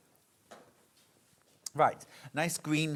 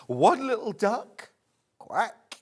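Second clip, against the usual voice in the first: louder, higher bingo call; bingo caller; 8 semitones higher, 6 dB louder, slower than usual. Acted. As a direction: belt out the number to the hall. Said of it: "you think I missed my vocation".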